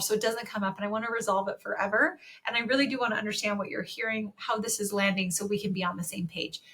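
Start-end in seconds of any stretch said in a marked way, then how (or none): none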